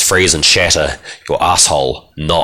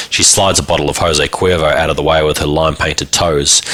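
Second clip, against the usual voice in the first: ordinary delivery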